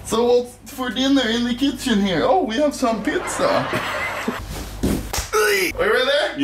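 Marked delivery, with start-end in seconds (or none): 3.5-5.3 shouted laugh. Real, not acted. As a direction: burst out laughing hard. Dough fails, bursts out laughing.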